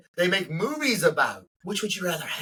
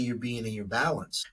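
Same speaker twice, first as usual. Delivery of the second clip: ordinary delivery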